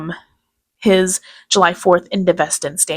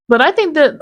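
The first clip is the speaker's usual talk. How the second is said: ordinary delivery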